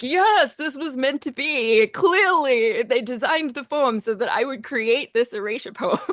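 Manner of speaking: elated yes, tipping toward a laugh; triumphant delight. Retold or imitated. Composer recalling her joy at the final line, triumphant delight. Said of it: "I was like".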